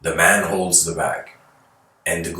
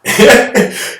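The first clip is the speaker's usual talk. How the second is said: loud laugh; 8 dB louder than usual. Real, not acted. Laughing at own impression.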